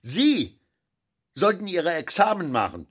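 put-on a father needling the young man; snide old director. A narrator's reading. Director Fischel needles Hans Sepp, high and mocking.

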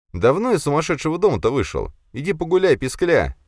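high-pitched scornful jibe; dismissive taunt. A narrator's reading. Hero brushes off the squeaky bully.

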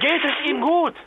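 high, urgent worried question; anxious question. Acted. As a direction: ask in fear for the child. Anxious question about her child.

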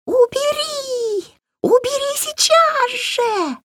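very high, slow, drawn-out shriek; shrieking kikimora. A narrator's reading. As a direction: shriek it in terror like a swamp hag. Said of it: "От визга у Вари заложило уши". (визг кикиморы)

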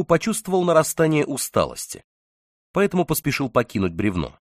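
ordinary delivery, nothing marked

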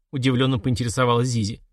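usual speaking voice

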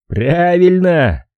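long drawn-out, high exclamation; Joe butting in. A narrator's reading. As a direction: chime in, stretching the word right out. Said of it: "вмешался Джо". (протяжно встревая, высоким голосом)

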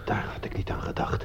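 hushed realization; whispered. Acted. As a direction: murmur it to yourself, whispering. Stunned realization.

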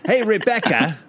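Cockney husband calling out to his wife; put-on Cockney, rising to a high call; Cockney accent. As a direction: holler for the missus in mock Cockney. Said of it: "it's, it's Cockney".

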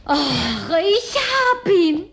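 high, drawn-out, loud cry of triumph; triumphant cry. Acted. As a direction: stretch it out high and loud, thrilled. Triumphant catch of the piano.

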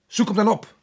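loud, curt order; impatient snap. Acted. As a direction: bark the order down the line. Resident impatient on the phone.

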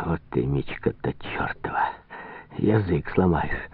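low, hushed grumble; half-whispered curse to a companion; muttered aside. Acted. Farkov aside to Prokhor about the hard name, muttering low.

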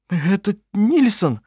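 high, slow, quavering plea; trembling voice. A narrator's reading. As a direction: voice shaking with tears. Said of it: "дрожащим голосом произнёс он".

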